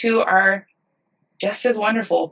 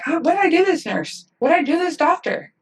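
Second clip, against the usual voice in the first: high-pitched imitation of wounded bewilderment; mock dismay; 10 semitones higher than usual. New staffer reacting to colleagues' attitude, mock dismay. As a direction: voice the hurt question way up high.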